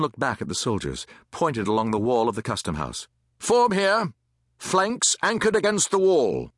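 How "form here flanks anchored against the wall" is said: high, projected command; barked military orders. A narrator's reading. Captain lines soldiers along wall, commanding loudly. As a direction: call out positions to the troops.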